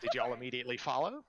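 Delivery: ordinary talk, nothing marked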